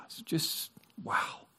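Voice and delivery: whispered wonder; preacher overcome, whispering; awed whisper. Real, not acted. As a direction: breathe it out in awe. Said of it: "awed hush".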